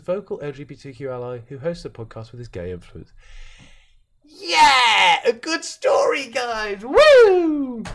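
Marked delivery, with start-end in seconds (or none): 4.2-7.8 high, loud whooping cheer; hammed-up victory whoop; mock celebration. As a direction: cheer like you won.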